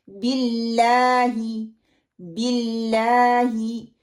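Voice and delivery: slow BILLAAHI with clean short I; correct pronunciation. Shown as a demonstration. Teacher modelling the right zer in बिल्लाही, slowly. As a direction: draw out LAA in बिल्लाही, keep I pure. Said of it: "जेर को अदा करते हुए".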